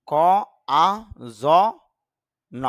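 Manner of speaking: ক, আ, জ, ন, each sound alone with pauses; spelled out sound by sound. Shown as a demonstration. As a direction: break the word into single sounds. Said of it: "IPA তে খেয়াল করলে দেখা যায়".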